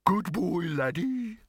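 high, slow, dopey dog voice; dim talking dog. A narrator's reading. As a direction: play a baffled, slow-witted hound. Slow-witted dog speaks in story.

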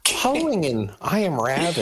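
high-pitched, goofy interviewer voice; comic put-on voice, way up high. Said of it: "you just sound like [name]".

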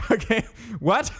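high, puzzled question; baffled okay what. Retold or imitated. Confused by vague directions, baffled. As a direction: act lost and confused.

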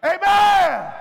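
high, loud, drawn-out amen; shouted amen. Acted. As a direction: belt it to lift the church.

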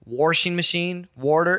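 "washing" and "water" slowed, with an R added; Midwestern pronunciation. Retold or imitated. Son mimicking what dad still says, Midwestern pronunciation. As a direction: say "washing" as WORSH-ing, "water" as WOR-ter. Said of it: "but he still says".

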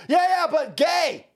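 high, slow, hammed-up shout; mock shouting activist. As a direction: yell it like a cartoon activist. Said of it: "They go".

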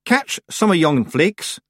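high, slow dialect voice; Rob Anybody. A narrator's reading. Rob instructing Tiffany about the flakes, high and slow. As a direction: pitch it up and draw out the words.

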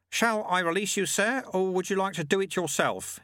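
high-pitched, polite little monk; Lutze, courteous monk. Acted. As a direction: offer help deferentially in a high voice.

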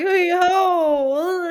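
high startled reply, half crying out; taken aback. Retold or imitated. Mother caught off guard answering her daughter's patois, taken aback. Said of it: "And I'm like".

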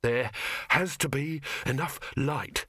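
slow, out-of-breath words; panting. A narrator's reading. Victor to himself, panting as he climbs. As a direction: gasp it out between steps. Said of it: "he panted".

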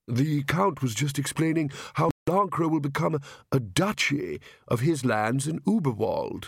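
mild-mannered king explaining politely; careful, slightly dazed royal voice; earnest, harassed king. Acted. King explaining the Count's plan to Nanny, meekly earnest.

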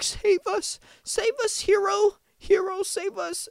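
very high, whiny damsel cry for help; mock plea. Zelensky begging the U S in a very high, whiny mock plea. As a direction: beg like a helpless cartoon victim. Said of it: "be like".

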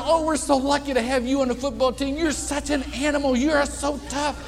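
butter him up warmly; gushing flattery. Retold or imitated. Steve flattering the tackle.

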